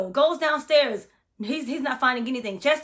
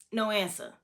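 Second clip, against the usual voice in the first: normal talking voice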